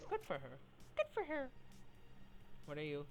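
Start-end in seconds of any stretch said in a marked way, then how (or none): 1.0-1.5 higher mock voice. Repeated reaction, second take.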